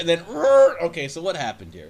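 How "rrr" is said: high, loud playful growl; a short comic snarl; mock growl. Host caps his romance parody with a loud playful growl.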